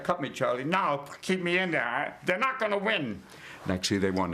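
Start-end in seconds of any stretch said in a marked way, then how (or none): 0.0-3.2 high, hammed-up boxer begging his cornerman; mock desperate fighter, pitch climbing; battered boxer. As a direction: play a beaten fighter insisting on staying in. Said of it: "It's like".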